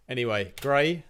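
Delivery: normal talking voice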